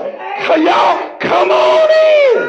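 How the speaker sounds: high, slow, shouted y'all come on in; mock welcoming call. Preacher mimicking a family inviting the spirits in, hammed-up shouted welcome. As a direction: sing out a big over-the-top welcome.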